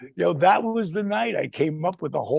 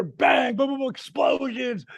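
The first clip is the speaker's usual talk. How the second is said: hyped explosions; 9 semitones higher than usual. Retold or imitated. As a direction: shout the pyro hits like a showman. Acting out his pyro entrance.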